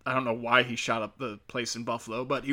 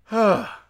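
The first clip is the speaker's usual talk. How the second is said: exasperated loud sigh; 9 semitones higher, 8 dB louder than usual. Real, not acted. Exasperated at the argument.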